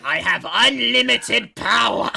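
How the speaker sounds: Palpatine. Acted. Emperor boasting of his power, hammed-up loud cry. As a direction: draw it out in hammed-up triumph. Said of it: "Do it".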